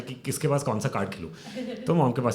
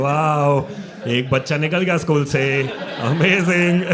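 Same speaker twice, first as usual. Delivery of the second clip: mock thrilled parents; 6 dB louder than usual. Parents voiced, mock delight.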